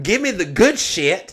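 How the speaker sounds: high, loud and drawn out; mock demand. Customer to bartender at a free bar, exuberant mock demand. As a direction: belt out a comic demand.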